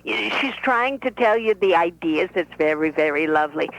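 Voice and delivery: put-on lively old Brooklyn bubby; Bubby's voice. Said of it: "This is the Bobby talking".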